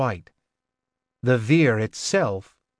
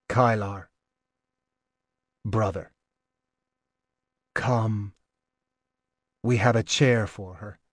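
tender; 3 semitones lower, 3 dB quieter than usual. A narrator's reading. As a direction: speak gently through tears. Tearful Logan comforts Kylar.

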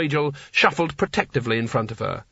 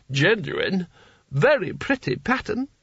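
much higher, airy agreement; tipsy Seraph; 6 semitones higher, slower than usual. A narrator's reading. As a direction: toss it off like a contented drunk. Seraph describing carpet.

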